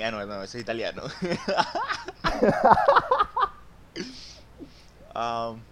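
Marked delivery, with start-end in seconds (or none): 1.1-3.5 high, loud burst of laughter. Real, not acted. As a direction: crack up out loud. Bursting laughter at own joke.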